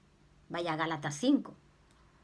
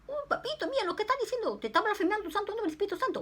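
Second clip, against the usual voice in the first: put-on high, fast little-girl plea; childlike alarm; 13 semitones higher, much faster than usual. Preacher telling God about the blasphemer, high and fast. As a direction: tattle to papito in high alarm.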